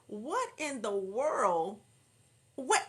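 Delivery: loud, slow, drawn-out amazement; slow astonished exclamation. Real, not acted. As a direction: stretch it out in loud disbelief. Astonished at the story.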